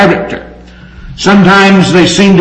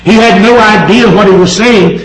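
normal talking voice

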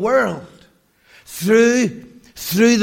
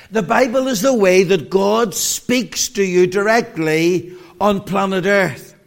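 ordinary delivery

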